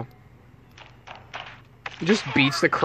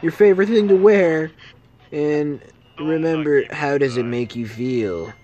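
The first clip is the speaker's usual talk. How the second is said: building louder sinister taunts; taunting villain; 3 semitones higher, 6 dB louder than usual. Acted. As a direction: list them teasingly, growing louder. Killer taunting victim.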